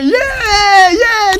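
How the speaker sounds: a high, loud whoop of welcome; bursting "yay, yay" of delight; excited cheering. Acted. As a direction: cheer like the church just got a new baby. Cheering a newcomer in church.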